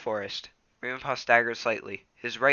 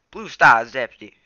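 usual speaking voice

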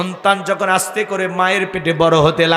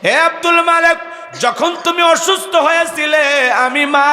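mother's anguished cry; 8 semitones higher than usual. Acted. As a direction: cry out his name in grief. Mother calls out to son.

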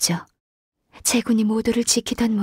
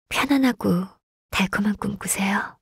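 softer, tender goodnight wish; hushed bedtime goodnight; 3 semitones lower, 3 dB quieter than usual. Acted. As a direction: gently wish them sweet dreams. Saying goodnight to the listener.

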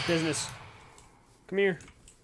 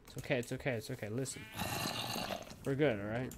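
soothing the animal; 6 dB quieter, faster than usual. Real, not acted. Calming the fox he steals.

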